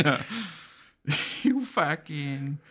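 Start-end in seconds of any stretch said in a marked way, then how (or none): 1.4-2.6 put-on slow, drawn-out thick Boston accent; angry Boston accent rant. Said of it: "thick Boston accents".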